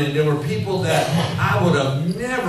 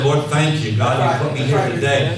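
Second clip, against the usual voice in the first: louder, building preached prayer; fervent prayer; 2 semitones higher, 5 dB louder than usual. Shown as a demonstration. Preacher modelling a prayer for the congregation, loud and fervent. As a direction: pray it out with rising fire. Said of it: "So learn to say".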